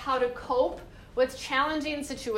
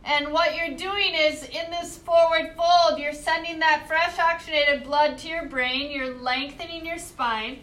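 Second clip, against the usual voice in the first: yoga instruction; 2 semitones higher, 4 dB louder than usual. Acted. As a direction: lead the class through each pose. Teacher cues forward fold.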